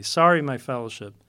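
normal talking voice

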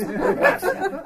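high, slow, laughing; real laughter while reading. Real, not acted. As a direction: laugh it out, delighted. Amused at spell check on the Bible.

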